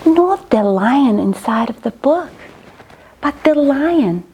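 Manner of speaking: usual speaking voice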